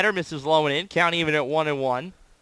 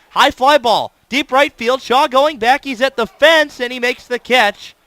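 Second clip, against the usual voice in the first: rising, louder play-by-play call; excited call; 5 semitones higher, 6 dB louder than usual. Real, not acted. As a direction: build it like a ball headed for the fence. Play-by-play call of deep fly.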